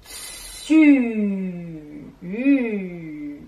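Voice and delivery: u tenu longuement et fort; son u allongé. Shown as a demonstration. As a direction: tenez le u bien rond. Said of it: "on entend". (u prolongé et appuyé)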